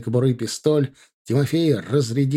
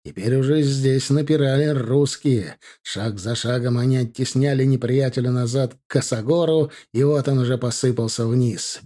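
usual speaking voice

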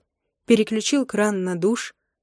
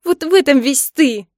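raised, higher-pitched reproach; angry accusation; 6 semitones higher, 7 dB louder than usual. A narrator's reading. Wife punches husband in the chest, accusing angrily. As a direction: throw the blame at him loudly.

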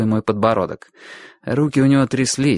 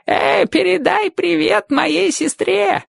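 put-on old man calling out; slower than usual. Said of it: "крикнул старик мне вдогонку".

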